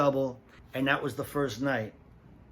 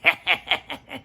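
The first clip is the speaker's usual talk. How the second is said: mocking laugh; 6 semitones higher, 7 dB louder than usual.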